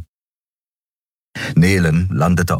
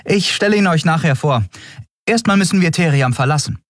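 high, brisk character voice; evasive Endriel; 12 semitones higher, faster than usual. A narrator's reading. Endriel puts off questions about the customer, high brisk voice.

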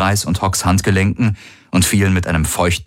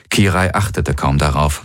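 ordinary delivery

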